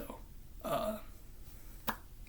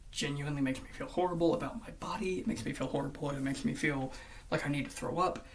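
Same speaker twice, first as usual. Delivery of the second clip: normal talking voice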